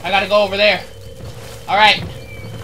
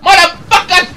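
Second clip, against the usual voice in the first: high yelling taunt; taunting shout; 14 semitones higher, 11 dB louder than usual. Real, not acted. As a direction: bellow as you chase them. Chasing enemies as Ewok.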